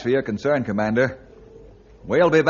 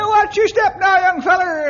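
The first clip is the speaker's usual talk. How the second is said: shouted warning; 9 semitones higher, 4 dB louder than usual. Acted. Old man warns on ice.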